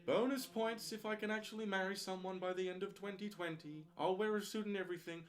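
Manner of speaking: a mock wedding officiant; solemn minister voice. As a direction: speak like a pompous celebrant. Officiant voice for ordination.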